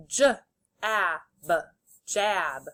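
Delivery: "J, ab" as separate sounds, then "jab" whole; sounded out, blended. Shown as a demonstration. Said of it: "say the sounds and then blend".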